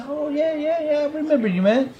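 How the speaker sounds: high put-on voice, eager and friendly. Guitarist greeting a fan who interrupted his breakfast, in a high imitating voice. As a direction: play the guitarist happily remembering a fan. Retold quote of guitarist [name].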